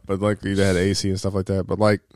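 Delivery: normal talking voice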